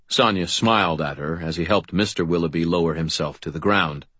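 ordinary delivery

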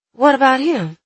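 unsure and wary; uncertain. A narrator's reading. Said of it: "her voice uncertain".

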